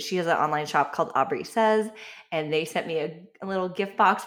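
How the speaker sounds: ordinary talk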